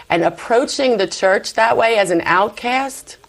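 usual speaking voice

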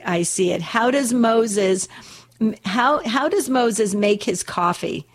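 usual speaking voice

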